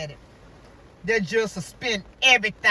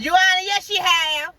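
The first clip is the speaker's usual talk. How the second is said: insistent lawyer; 7 semitones higher, 6 dB louder than usual. Retold or imitated.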